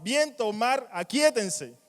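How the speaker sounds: a contundent command from someone with authority; commanding order. Acted. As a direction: order the storm to be still. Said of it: "Jesús dio la orden".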